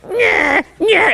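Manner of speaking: high taunting "Nyeh, nyeh"; mocking nyeh. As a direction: tease yourself like a child. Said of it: "my brain is like".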